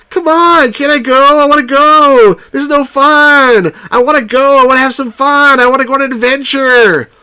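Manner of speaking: put-on high, loud whining kid voice; whiny kid Luke. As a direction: whine like a spoiled boy begging to go. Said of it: "a sort of a whiny kid".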